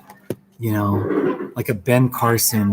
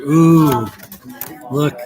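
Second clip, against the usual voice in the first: delighted ooh; 3 semitones higher, 5 dB louder than usual. Real, not acted. Delighted at snowy saguaro picture.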